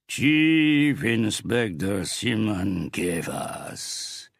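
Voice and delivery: booming, drawn-out announcement; thundering. Acted. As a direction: bellow the name slowly. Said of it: "the big man thundered".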